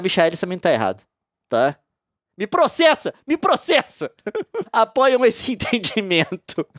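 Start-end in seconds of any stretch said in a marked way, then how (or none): 2.4-4.1 higher, daring cry; taunting, come and sue me; mock defiance. Mocking the lawsuit in front of viewers. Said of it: "senão me processam também". 4.2-4.7 laugh. Real, not acted. Laughing at own joke.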